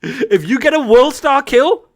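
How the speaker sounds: loud, high shout easing at the end; acted furious dismissal. Coach showing a star player the door, shouting in mock fury. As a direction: yell it, then drop to a curt send-off.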